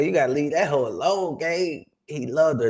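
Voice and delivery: normal talking voice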